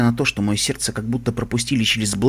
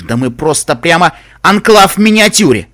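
loud, higher-pitched self-rebuke; exasperated outburst; 6 semitones higher, 8 dB louder than usual. A narrator's reading. Лайфблум likening his society to the enclave, exasperated. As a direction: burst out in self-disgust. Character exclaims in self-disgust.

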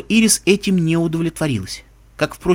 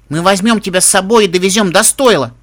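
exclaiming; 5 semitones higher, 6 dB louder than usual. Acted. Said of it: "воскликнула Ирис".

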